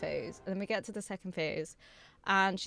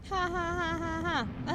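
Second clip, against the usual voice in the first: mock villain laugh; 7 semitones higher, slower than usual. Mimicking boss laugh.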